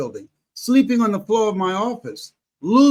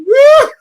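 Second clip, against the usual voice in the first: a sudden shrieking laugh; real laugh; loud high laugh; 13 dB louder than usual. Real, not acted. Laughing after retold crying.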